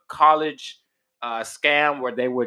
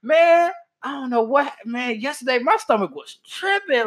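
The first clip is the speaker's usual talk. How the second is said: retelling own complaint; 11 semitones higher than usual. Retold or imitated. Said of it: "I'm like".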